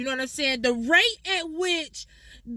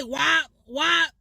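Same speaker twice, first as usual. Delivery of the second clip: wop call; 3 dB louder than usual. Retold or imitated. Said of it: "you hear somebody say".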